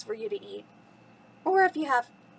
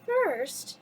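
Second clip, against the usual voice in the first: Belle talking to herself; 6 semitones higher than usual. A narrator's reading. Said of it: "she said out loud".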